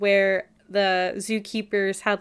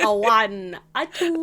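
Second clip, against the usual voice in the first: Count voice. Pretend. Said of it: "you still sound like the Count".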